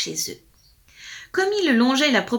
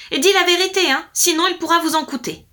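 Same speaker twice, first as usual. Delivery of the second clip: high, loud warning; shouted threat; 8 semitones higher, 7 dB louder, faster than usual. A narrator's reading. Lord warns the wife to tell truth, shouting. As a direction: press the threat with raised voice. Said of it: "lui cria le Seigneur".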